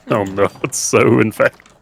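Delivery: ordinary delivery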